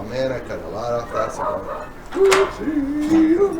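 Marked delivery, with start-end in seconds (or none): 2.1-3.6 surprised. Real, not acted. Cut too much off cigar.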